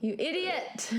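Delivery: exasperated scolding. Real, not acted. Reacting to co-host's mistaken text, exasperated scolding. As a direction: scold a friend in disbelief.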